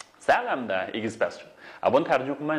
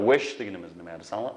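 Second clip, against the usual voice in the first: ordinary delivery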